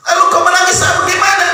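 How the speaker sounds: high, loud asking why she cries. Acted. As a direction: call out the question. Prophet asking mother, raised.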